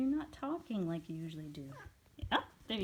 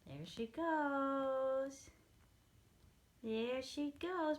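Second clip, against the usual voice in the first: drawn-out; 8 semitones higher, 3 dB louder, much slower than usual. Real, not acted. Watching cat leave.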